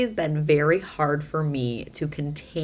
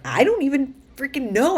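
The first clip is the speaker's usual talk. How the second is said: higher, louder exasperated burst; frustrated outburst; 10 semitones higher, 6 dB louder than usual. Retold or imitated. Someone raised to stop feeling, exasperated outburst. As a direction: vent the frustration, fed up. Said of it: "You're like".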